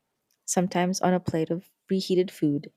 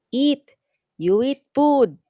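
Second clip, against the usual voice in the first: put-on higher and louder, slow old grandma; louder Lola; 8 semitones higher, 7 dB louder, slower than usual. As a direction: push the words out louder to a grandchild who didn't hear. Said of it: "she says a little louder".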